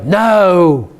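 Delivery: lightly voiced class begging, high and urgent; pupils protesting. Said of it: "And they go".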